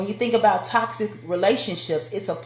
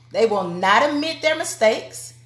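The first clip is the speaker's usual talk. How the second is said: ordinary delivery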